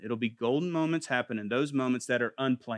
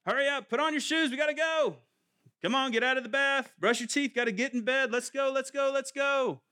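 rushed parent; 12 semitones higher than usual. Retold or imitated. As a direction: hurry the kids along like a stressed parent. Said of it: "We're always in a hurry".

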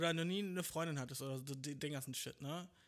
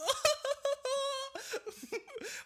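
high, long mocking cackle; mocking laugh; 7 dB louder than usual. Whole ticket office laughing at him, mocking cackle. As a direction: crack up at him. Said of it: "die lachen alle so".

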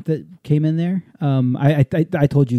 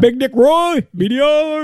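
much higher, louder hype call; hype announcement; 11 semitones higher, 4 dB louder, slower than usual. Real, not acted. Husband hypes his coworker's nickname, excited announcer call. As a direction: announce the nickname like a ring intro.

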